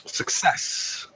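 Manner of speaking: whispered little victory cry; barely voiced, savour the win; hushed mock triumph. Player as the guard gets through, hushed triumph.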